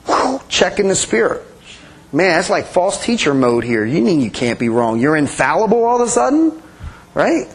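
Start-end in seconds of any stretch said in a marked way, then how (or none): none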